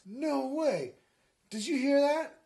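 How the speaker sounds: high, loud mock disbelief; hammed-up astonishment; incredulous. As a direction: blurt it out, amazed. Acted Mary's disbelief in story.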